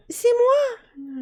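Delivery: very high, whispered then voiced fairy voice; a little fairy greeting a boy; tiny fairy. Fairy greets Jacques at the hearth, tiny high voice. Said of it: "lui répond la petite fée".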